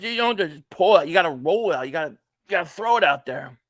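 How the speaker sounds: ordinary talk, nothing marked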